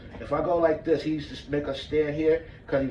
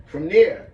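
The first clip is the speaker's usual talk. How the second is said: sharp gasp then loud push out; 3 semitones higher, 10 dB louder than usual. Shown as a demonstration. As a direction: gasp and drive the words out. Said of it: "Now you go".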